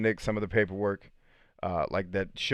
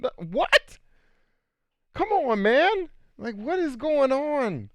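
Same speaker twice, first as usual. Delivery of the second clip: much higher, loud disbelief; incredulous; 4 dB louder, slower than usual. Retold or imitated. Young man baffled by an old woman's advance, high and incredulous. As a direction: squeal out a shocked what, slowing at the end.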